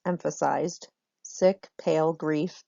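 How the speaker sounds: ordinary delivery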